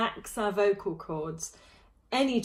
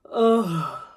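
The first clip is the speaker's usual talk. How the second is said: loud, slumping sigh of relief; end of the week sigh; 7 dB louder than usual. Shown as a demonstration. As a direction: flop onto the sofa and let out a loud Oh. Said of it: "flop on the sofa and go".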